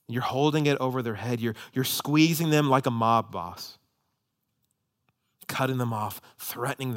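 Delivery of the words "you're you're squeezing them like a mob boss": normal talking voice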